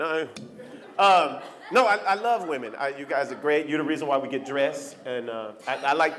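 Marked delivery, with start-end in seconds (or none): none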